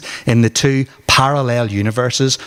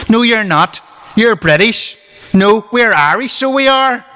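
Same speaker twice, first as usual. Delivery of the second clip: loud, high, heated back-and-forth yelling; escalating shouting match; 11 semitones higher, 4 dB louder than usual. Acted. Rival teens yelling British versus Irish. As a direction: shout each other down, getting higher.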